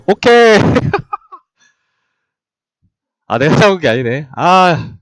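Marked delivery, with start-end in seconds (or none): 0.1-0.6 excited. Real, not acted. Winning moment. 0.6-1.3 breathy laugh. Real, not acted. Laughs after win.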